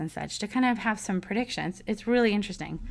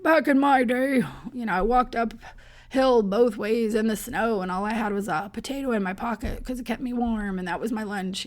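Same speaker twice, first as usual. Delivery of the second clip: put-on old grandpa telling how it was; back-in-my-day old man, higher voice; grandfather's voice; 3 semitones higher than usual. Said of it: "I'm channeling my grandfather's voice".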